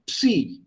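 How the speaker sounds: "psi" as p-s blended into a high ee; P S together. Shown as a demonstration. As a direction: model "psi" with the p audible before the s. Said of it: "P S together".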